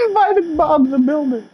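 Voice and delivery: high-pitched squealing voice. Acted. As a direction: squeal it in disbelief. Mocking shriek at character.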